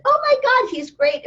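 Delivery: high, excited exclamation; mock amazement. Woman greeting dad ready to scream, excited amazement. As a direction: exclaim it high to head off worry. Acted reassurance to the arriving dad.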